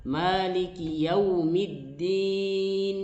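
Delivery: Maliki Yaumiddin drawn out slowly; slow recitation. Shown as a demonstration. Teacher repeating the correct verse for students, slow. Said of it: "seperti itu".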